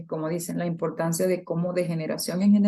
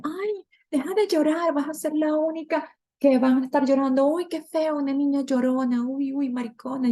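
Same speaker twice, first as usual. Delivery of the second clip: higher, taunting voice of a scolding mom; mocking mother; 8 semitones higher than usual. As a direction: mimic a mother shaming her crying girl. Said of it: "que le decía".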